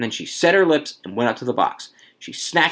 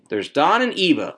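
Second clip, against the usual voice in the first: higher-pitched protest; mild yell; 6 semitones higher than usual. A narrator's reading. Wife objecting about other households, mild yell. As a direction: call it out in objection. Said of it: "Missus Hutchinson yelled".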